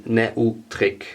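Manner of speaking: Neutrik sounded out slowly, letter for letter as Ne-u-trik; pronounced as written. Shown as a demonstration. Drummer showing viewers how the brand is written, Neutrik slowly said as spelled. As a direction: say Neutrik slowly, with E and U as separate vowels. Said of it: "it's written".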